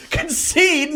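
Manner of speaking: high, loud disbelief; shocked yelp; incredulous. Real, not acted. Incredulous during thumb war.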